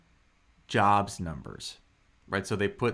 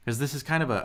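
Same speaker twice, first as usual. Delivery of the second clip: ordinary talk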